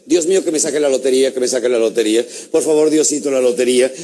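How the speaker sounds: hammed-up begging to God; repetitive praying. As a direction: plead in a droning loop. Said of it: "aquel hombre reza y reza".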